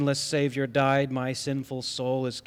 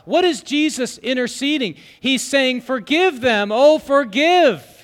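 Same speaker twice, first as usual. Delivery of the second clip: loud, high, driving sermon voice; full-force preaching; 10 semitones higher, 9 dB louder than usual. Acted. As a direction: preach it out with fire. Preaching, quoting hymn.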